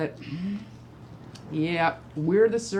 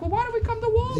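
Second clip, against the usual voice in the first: much higher, exasperated question; incredulous retort; much faster than usual. Retold or imitated. Mother retorting to her son in the store, much higher and exasperated. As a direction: throw the question back at him. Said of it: "I'm like".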